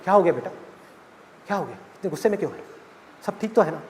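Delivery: soft, quick, calming dad; soothing father. Acted. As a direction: settle her down gently. Father soothes angry daughter. (गुस्साई बेटी को शांत करते पिता की नरम आवाज़)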